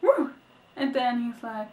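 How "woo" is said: rejoice that the couple can stay together; joyful cheer. Retold or imitated. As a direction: reader celebrating in delight. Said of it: "I was like".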